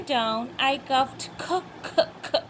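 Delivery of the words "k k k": "k" as a short voiceless puff, repeated; cough sound. Shown as a demonstration. Teacher tells pairs to make the cough sound, isolated "k" bursts. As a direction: make three separate little cough-like "k" sounds. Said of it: "make the cuff sound".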